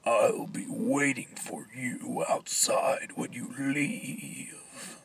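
slow, menacing whisper; creepy whisper. Acted. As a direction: whisper the threat slowly.

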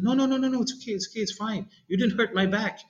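high, hurried voice; mock kid reassuring. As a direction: brush it off as the nerdy kid. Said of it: "this was like".

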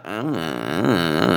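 slow, low, stammering uh uh uh; dumbfounded stammer. Retold or imitated. Tommy asked about the missing girl, low slow stammer. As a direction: play Tommy lost for words, dragging it out. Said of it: "And he's like".

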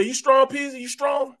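high-pitched mock challenge; taunting. Lifter goading teammate Peasy, high imitated taunt. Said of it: "talking crazy to him".

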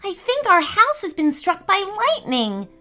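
high-pitched, dismayed disbelief. Acted. Sister realizing the house was struck, disbelief. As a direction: break the bad news, hardly believing it. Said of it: "said Ellie in disbelief".

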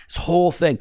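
usual speaking voice

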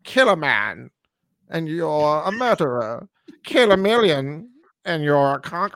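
lightly imitated Lithgow villain accent, slow and drawn out; a mild mocking take on Lithgow's posh villain accent. Podcaster mocks Lithgow's line in Lithgow's villain accent. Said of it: "I mean, his accent".

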